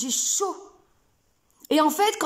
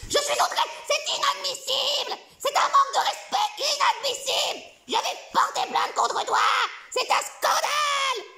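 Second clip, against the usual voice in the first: outraged bestiole; 12 semitones higher than usual. Acted. Insulted bestiole protests to the coach, high outraged squeal. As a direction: shriek your offence like a prissy little creature.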